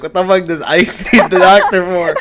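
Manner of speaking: baffled outburst cracking into a laugh; laughing disbelief. Real, not acted. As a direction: crack up mid-question. Cracking up over misheard word.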